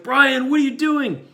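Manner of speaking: high, loud alarmed shout at a friend; alarmed outcry. Retold or imitated. Driver to friend who threw milk, high alarmed shout. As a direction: cry out in shock at what he just did. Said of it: "I was like".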